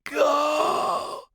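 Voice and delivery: high forceful croak; insistent croak. A narrator's reading. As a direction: push the word out hard. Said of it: "he insisted".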